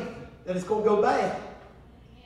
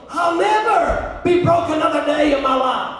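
imitated shout, higher and louder; retold scream; 6 semitones higher, 6 dB louder than usual. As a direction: cry it out like a fervent declaration. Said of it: "I heard her screaming".

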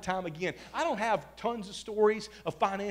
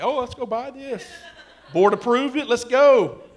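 playful eagerness, building louder; eager mock excitement; 4 semitones higher, 7 dB louder than usual. Pastor mimicking himself spending church money, eager mock excitement. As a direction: act gleefully keen, punching the last words.

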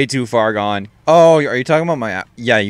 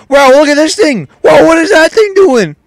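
high, loud mock amazement; amazed yelling; 12 semitones higher, 8 dB louder than usual. Gamer clowning over the blue shell, amazed yelling. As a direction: yell in hammed-up wonder.

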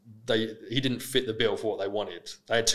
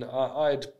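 ordinary talk

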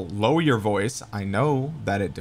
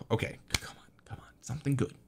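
softer pleading whisper; 11 dB quieter, faster than usual. Real, not acted. Gamer whispering a plea for a good pull. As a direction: whisper it like a prayer to the game.

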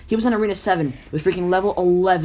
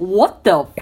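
mock outraged kid; 3 semitones higher, 6 dB louder, slower than usual. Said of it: "And he goes".

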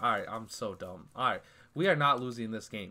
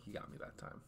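whispered; 16 dB quieter than usual. Real, not acted. Repeated line whispered then loud.

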